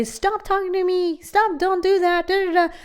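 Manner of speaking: high, mocking yell of stop talking to me; mock scream at the voice. Shown as a demonstration. A speaker mocking how you'd yell at your inner voice, high mock yell. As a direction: shriek it like someone losing patience. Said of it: "I didn't scream at this voice".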